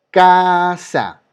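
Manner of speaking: Casa with a long, loud, high CA; stress on first syllable. Shown as a demonstration. Teacher showing students the strong syllable, stress on CA in Casa. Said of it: "La clave para todo esto es escuchar".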